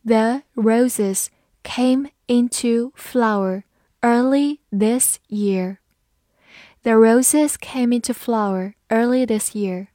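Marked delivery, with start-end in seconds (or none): 0.0-5.8 slow, word-by-word model reading; unhurried teacher's demonstration, each word separate. Shown as a demonstration. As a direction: read it slowly with a gap after each word. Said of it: "好，慢慢来读".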